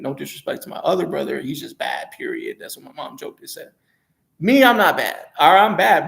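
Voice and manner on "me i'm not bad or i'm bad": louder, higher, playful self-brag; louder, higher cheeky boast about being bad; playful outburst. Real, not acted. Joking boast about himself.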